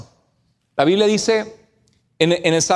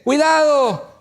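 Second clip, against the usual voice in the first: imitated warning cry; 8 semitones higher, much slower than usual. Wife warns husband of a near crash, mock yell. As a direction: imitate a startled passenger calling out. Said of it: "mi esposa gritó y me dice".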